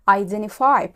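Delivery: "Identify" as "eye-den-ify"; dropped T. Shown as a demonstration. Teacher answering her own question, dropping the T. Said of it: "هتقال إيه".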